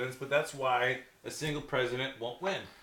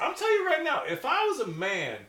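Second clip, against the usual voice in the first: excited; 14 semitones higher, 6 dB louder than usual. Real, not acted. Emphatic outburst in argument.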